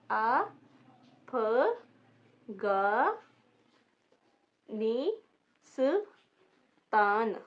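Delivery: अफगानिस्तान stretched extremely slowly while writing; dictation pace. Shown as a demonstration. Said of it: "हम English में लिखते हैं कैसे".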